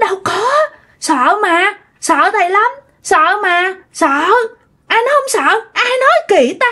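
put-on high, protesting kid voice; imitated child squirming out of trouble; backpedalling child. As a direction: deny it hastily like a scared child. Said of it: "theo kiểu chói mà làm vậy".

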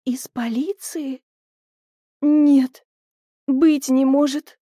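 slow, stunned disbelief; incredulous echo turning emphatic; astonished. A narrator's reading. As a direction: start hushed and slow, then burst into denial. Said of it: "с открытым от удивления ртом".

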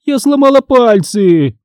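high, stretched-out yelp of pain; pained squeal. A narrator's reading. Teacher who has hurt her fingers, squealing in pain. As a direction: wail it high, as if badly hurt. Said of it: "она взвизгнула от боли".

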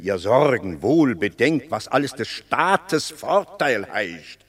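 ordinary talk, nothing marked

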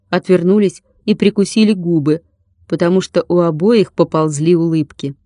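usual speaking voice

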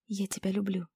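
soft, hushed I love you; tender confession. A narrator's reading. Girl tells Ryan she loves him, soft and tender.

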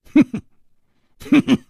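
two short high humphing laughs; scornful snicker. Acted. Killer scoffs at his opponent, short scornful snickers. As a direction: snort with contempt, twice.

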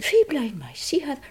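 Irish-accented demonstration, lifting in pitch. Shown as a demonstration. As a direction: give it a light Irish lilt. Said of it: "Sam spoke like this".

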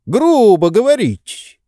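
high, loud reproach; indignant rebuke. A narrator's reading. Master scolds servant for rudeness, indignant. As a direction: rebuke him with rising outrage.

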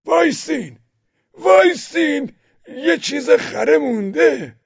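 very high, loud, slow shout; calling out. Acted. Old villager shouting after the buyers, high and loud. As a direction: call after them down the road.

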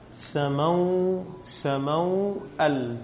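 سموأل drawn out syllable by syllable, very slowly; teacher dictating سموأل slowly to the class; slow spelling-out. Shown as a demonstration. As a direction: stretch سموأل so the class hears each sound. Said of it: "على إيش أرسمها؟".

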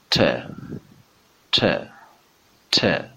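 ت as an English t with a hint of "ch"; English t demo. Shown as a demonstration. Teacher models the English letter t, crisp alveolar ت. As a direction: tap the gum ridge for ت, faintly ch-like. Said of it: "یه مقداری از بوی حرف چه".

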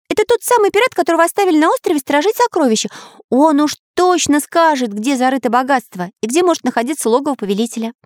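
high, quick cartoon hero voice; keen artist character. Artist tells friends about the pirate, high eager voice.

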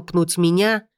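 ordinary delivery

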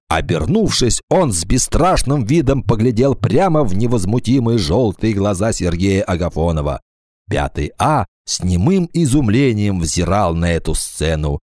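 ordinary delivery

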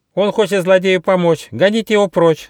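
very high, loud alarmed screech; shrieking monkey. Acted. Monkey rouses animals against the tiger's helper, shrieking. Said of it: "завопила мартышка Коко".

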